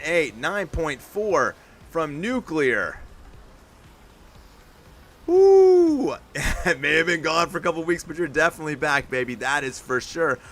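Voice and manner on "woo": much higher, very loud whoop; whooping cheer. Real, not acted. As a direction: let out a big celebratory woo. Cheer after scores.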